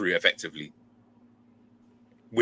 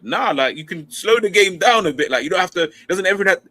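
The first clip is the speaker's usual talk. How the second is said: higher, louder mock pleading; young player arguing; 11 semitones higher, 6 dB louder than usual. Young player pushing back on coaches, high mock protest. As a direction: argue it like a cocky youth player. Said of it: "But I was always like".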